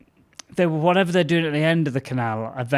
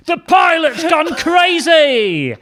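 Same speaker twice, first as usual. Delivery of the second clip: hammed-up high, loud, drawn-out headline scream; sensational tabloid shout; 9 dB louder, much slower than usual.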